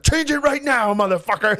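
high-pitched retold angry yell; mock outburst, voice jumping way up; furious demand. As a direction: imitate an enraged father demanding action. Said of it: "chasing me through the house".

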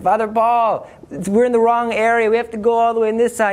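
high-pitched, hurried retold call; urgent calling out. Warning the priest they're in the wrong area, urgent. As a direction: call out urgently, speeding up toward the end.

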